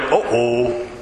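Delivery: low, sinking uh-oh; hammed-up dread, pitched well down; mock dismayed uh-oh. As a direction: caught out and knowing it, dropped low. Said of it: "Moses is like".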